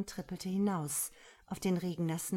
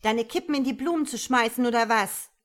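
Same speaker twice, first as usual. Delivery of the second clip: louder, higher scolding; angry yell; 4 semitones higher, 9 dB louder, faster than usual. A narrator's reading. Woman to man about butts in flowers, yelling. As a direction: scold him at full voice. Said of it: "brüllte sie".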